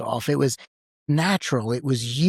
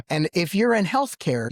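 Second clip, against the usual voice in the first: usual speaking voice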